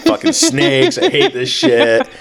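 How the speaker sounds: hammed-up grumbling, pitched high and dragged out; mock-angry Saint Patrick. Host as Saint Patrick flinging snakes, mock fed-up. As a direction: play an exaggerated snake-hater, slow and high.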